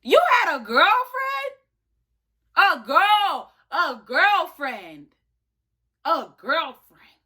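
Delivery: high, loud, slow incredulous gasp; shocked disbelief. Retold or imitated. Retelling her reply to the girl, high stunned disbelief. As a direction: let it rise in stunned disbelief, drawn out. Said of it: "So I'm like".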